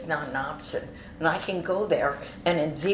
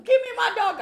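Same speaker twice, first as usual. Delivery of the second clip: high, loud yell for the dog back; demanding shout; 7 dB louder than usual. Retold or imitated. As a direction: shout it as the outraged owner. Acts out owner demanding dog back.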